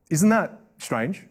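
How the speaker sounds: normal talking voice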